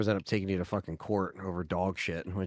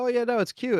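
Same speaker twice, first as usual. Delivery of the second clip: gushing dog buyer; 3 dB louder than usual. Retold or imitated. Voicing gushing buyers of certain breeds.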